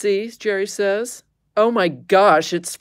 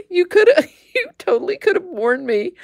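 laughing reproach; 11 semitones higher than usual. Real, not acted. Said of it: "Sorry, I forgot to warn you".

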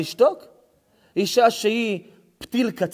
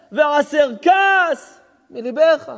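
shouted verse; 7 semitones higher, 4 dB louder, much slower than usual. Acted. Shouts King Solomon's verse.

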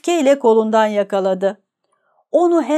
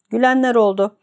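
ordinary delivery, nothing marked

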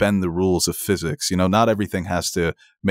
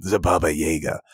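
put-on German accent; 3 semitones lower than usual. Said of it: "that ridiculous German accent".

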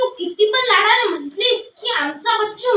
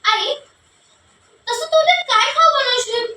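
normal talking voice

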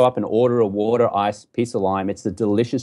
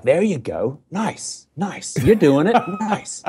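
mock American encouragement; 6 semitones higher, slower than usual. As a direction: imitate an encouraging American, pitch lifted. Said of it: "all this kind of encouragement".

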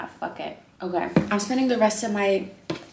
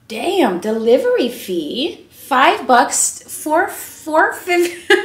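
louder, slower, higher disbelief; shocked at fee; 7 semitones higher, 10 dB louder, much slower than usual. Real, not acted. Vlogger outraged at the delivery fee, slow disbelief. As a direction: stretch out each number in outrage.

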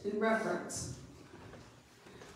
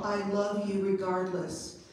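normal talking voice

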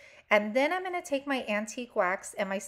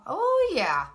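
normal talking voice

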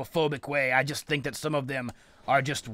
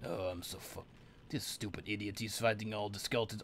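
low, very soft grumble; fuming mutter; 4 semitones lower, 12 dB quieter than usual. Real, not acted. Player watching his helper fight all the skeletons, fuming mutter. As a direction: seethe quietly to yourself.

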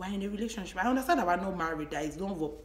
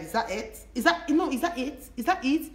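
rising challenge; 7 semitones higher, 4 dB louder than usual. Real, not acted. Challenging boyfriend's joke.

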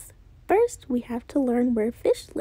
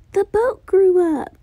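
louder, slower amazed announcement; excited repeat; 4 semitones higher, 7 dB louder than usual. Acted. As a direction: shout the news with wonder.

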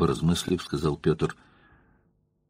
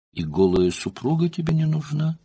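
alluring voice; 8 semitones higher than usual. A narrator's reading. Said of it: "совершенно спокойным, завлекающим голосом".

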